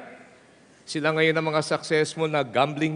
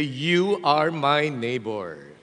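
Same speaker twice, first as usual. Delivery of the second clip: slow model line; much slower than usual. Shown as a demonstration. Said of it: "Look at that person and say".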